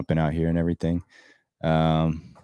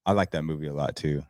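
ordinary delivery

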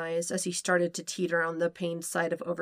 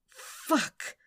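sharp breathy higher cry; cried out; 6 semitones higher than usual. A narrator's reading. Sokka crying out at his climax. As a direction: let it burst out on a gasp. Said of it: "he cried out".

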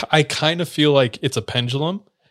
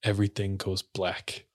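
brooding low voice; 4 semitones lower, 6 dB quieter than usual. Retold or imitated. Upset missionary kid confesses his temper to the teller, subdued. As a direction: retell it as a subdued, halting confession.

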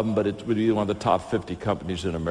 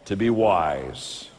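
slow, earnest closing prayer; slower than usual. Acted. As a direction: pray over the congregation.

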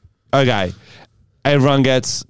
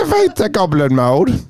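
higher goblin chant; 8 semitones higher than usual. Pretend. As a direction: switch on the goblin. Goblin play continues.

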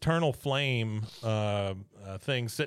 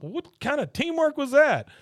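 much higher, louder, indignant question; outraged mock yell; 6 dB louder than usual. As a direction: blurt it out in shocked disbelief. [name]'s outraged retold question.

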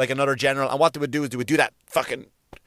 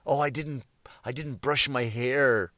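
mocking whine; 3 semitones lower, 6 dB quieter, slower than usual. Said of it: "people who have it fucking so tough".

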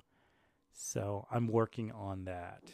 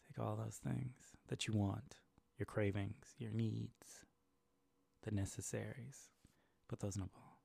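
guided meditation; 3 dB quieter than usual. Acted. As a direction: calmly guide the listener. Ball meditation.

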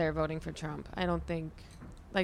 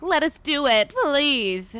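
high, loud mock pleading; put-on pleading producer voice; 7 semitones higher, 5 dB louder, slower than usual. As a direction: imitate producers begging in a raised pitch.

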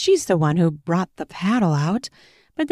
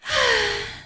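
high sigh. Acted. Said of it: "She sighs".

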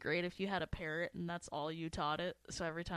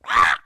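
parrot screech; 23 dB louder than usual. Pretend.

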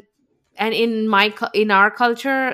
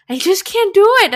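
high, loud, tearful plea; half-sobbing that she can't do it; tearful cry at the gym; retold crying plea; 10 semitones higher, 7 dB louder than usual. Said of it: "and I was crying".

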